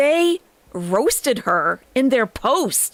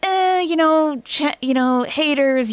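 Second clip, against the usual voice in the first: higher, whiny brush-off; mock dismissive; 8 semitones higher than usual. Mimicking Chantal live about haters, mock dismissive. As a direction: imitate her waving off critics in a raised pitch. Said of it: "she goes live and she's like".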